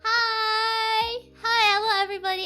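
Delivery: high, loud drawn-out hellos; yelling greetings. Retold or imitated. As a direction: yell out to everybody passing by.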